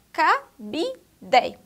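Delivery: "cabide" split ca, bi, de with a full final e; spelled-out syllables. Shown as a demonstration. Teacher showing pupils the syllables, spelled-out pronunciation. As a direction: say "cabide" in beats, ending DE, not dji. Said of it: "olha só".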